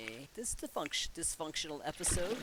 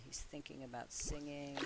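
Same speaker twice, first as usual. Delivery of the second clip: normal talking voice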